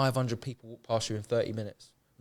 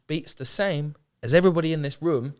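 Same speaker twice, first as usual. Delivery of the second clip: normal talking voice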